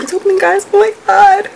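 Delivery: high, building to a loud cry; excited amazement. Real, not acted. Vlogger watching the boxers open, high excited squeal.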